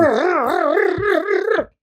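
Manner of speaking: loud, high, slow growling arr; mock-growled stand-in for rap bars; growled rhyme bursts. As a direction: roar out the rhythm of a rapid rhyme. Rhyming flat out on stage.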